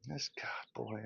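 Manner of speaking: breathy whispered aside. Real, not acted. Muttering to himself mid-rant in a whisper. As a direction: drop to a whisper, overcome. Overcome with passion.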